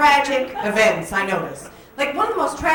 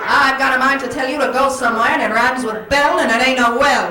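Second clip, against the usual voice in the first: put-on louder, sassy old-film telling-off; mock-indignant screen dame scolding; 1940s angry movie woman; 4 dB louder than usual. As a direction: put a fella in his place like a forties heroine. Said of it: "she'd say".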